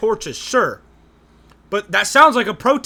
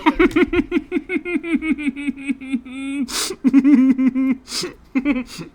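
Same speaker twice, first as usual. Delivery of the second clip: drawn-out, high cackling laugh; long laugh; 5 semitones higher than usual. Real, not acted. Reacting to the debate clip, long high laugh.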